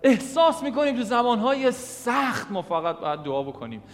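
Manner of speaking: loud, high, fervent preaching; preaching at full force. Acted. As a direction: drive the message home to the congregation.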